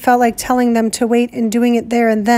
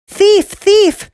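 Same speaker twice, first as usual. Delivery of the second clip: high, loud scream; shrieking accusation; 9 semitones higher, 5 dB louder than usual. Acted. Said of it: "Piggy screamed".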